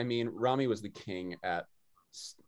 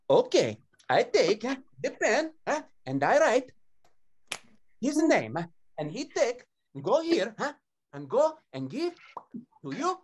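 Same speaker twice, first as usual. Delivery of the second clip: high, loud mock Italian accent; 14 semitones higher, 4 dB louder than usual. Son mimics dad talking back to consulate woman in Italian accent. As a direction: imitate a heavy Italian accent, pitched way up. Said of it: "in an Italian accent".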